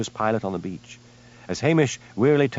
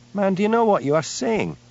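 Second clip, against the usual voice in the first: alarmed; 10 semitones higher than usual. A narrator's reading. Jimmy shocked at confession.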